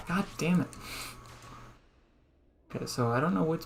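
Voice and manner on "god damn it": higher, falling "God damn it" with "damn" hit; exasperated at a bad move; frustrated curse. Real, not acted. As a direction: let "God damn it" out in real annoyance. Annoyed at the game, twice.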